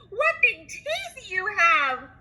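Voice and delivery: put-on high, slow frightened child; little girl voice. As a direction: draw it out in alarm.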